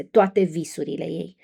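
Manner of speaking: ordinary delivery, nothing marked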